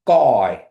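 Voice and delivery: "guy" said loud with the G at the back of the throat; "guy" pronunciation demo. Shown as a demonstration. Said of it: "the back of your throat".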